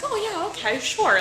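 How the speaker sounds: mock the doctor's indifference; lightly voiced bored doctor; dismissive doctor. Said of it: "he was like".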